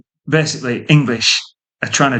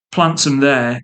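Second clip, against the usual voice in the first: normal talking voice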